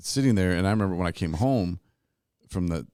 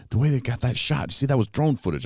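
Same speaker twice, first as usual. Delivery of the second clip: higher, keen film-buff gushing; excited pointing out; 6 semitones higher, 4 dB louder than usual. Retold or imitated. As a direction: point it out like a proud expert. Excitedly pointing out drone shot.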